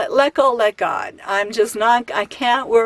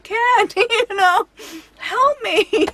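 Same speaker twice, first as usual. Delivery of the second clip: high call across the lot, much higher; calling for help; 11 semitones higher, slower than usual. Retold or imitated. Calling to her husband Kent at the van, high mock call for help. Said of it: "and I'm going".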